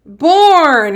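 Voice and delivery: blended Born called out; blended out loud. Shown as a demonstration. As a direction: land the blended word with a bright call. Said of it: "Way to blend those sounds".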